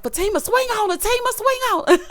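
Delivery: high, loud excited chant breaking into laughter; egging on a fight. Retold or imitated. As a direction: retell it like cheering on a brawl.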